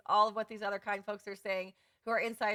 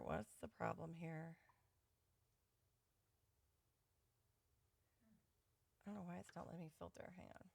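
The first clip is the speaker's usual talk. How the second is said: much softer, under her breath; muttering to herself; 3 semitones lower, 11 dB quieter than usual. Real, not acted. Host frustrated with laggy computer, muttering softly. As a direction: mutter at the screen.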